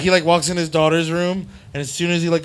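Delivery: ordinary delivery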